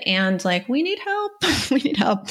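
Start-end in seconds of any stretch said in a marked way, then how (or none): 0.7-1.3 pleading. Retold or imitated. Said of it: "if you're like". 1.4-2.3 laughing through the words. Real, not acted. Laughs, repeats the plea.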